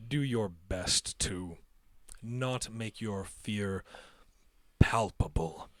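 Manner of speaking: low, soft, very slow caution; measured and hushed, with long pauses; tamer's careful warning. Acted. Tamer cautioning nervous riders about fear, careful slow warning.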